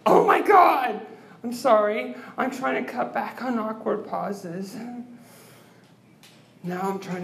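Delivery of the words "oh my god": loud, high burst of alarm; startled. Real, not acted. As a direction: yelp it as if caught off guard. Startled outburst after long pause.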